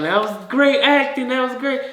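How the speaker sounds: high-pitched applauding crowd member; gushing praise. Acted. Family clapping for the actor who just died, gushing praise. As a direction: squeal it like an impressed audience.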